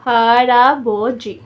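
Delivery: हराबोजी slow and loud, syllables drawn out; teacher's slow, emphatic model of हराबोजी; slow demonstration. Shown as a demonstration. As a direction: stretch हराबोजी out as ha-ra-bo-ji. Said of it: "यहाँ पे हर लगा दें साथ".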